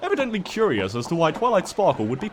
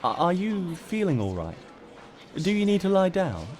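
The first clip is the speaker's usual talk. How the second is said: concerned teacher; 5 semitones higher, 3 dB quieter than usual. A narrator's reading. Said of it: "asked Miss Cheerilee".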